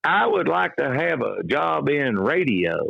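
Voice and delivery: high, slow Kentucky hillbilly voice; an eager country kid asking for a radio job, with a backwoods accent. Retold or imitated. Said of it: "backwood hillbilly accent".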